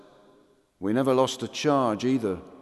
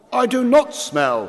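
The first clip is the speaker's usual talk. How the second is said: grinning; 12 semitones higher, 8 dB louder than usual. Acted. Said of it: "he grinned".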